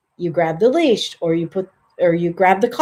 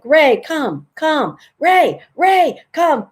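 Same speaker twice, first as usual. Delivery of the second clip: rising higher calls of Ray and come; repeated nagging call; 7 semitones higher, much slower than usual. Shown as a demonstration. Trainer showing the worthless repeated calling, rising. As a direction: keep calling, pitch climbing. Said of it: "so if you're like".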